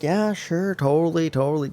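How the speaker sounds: mock going along with a smile; fake agreeable. Worker humoring coworkers' opinions, fake agreeable. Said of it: "smile, and be like".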